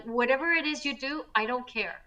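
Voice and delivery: normal talking voice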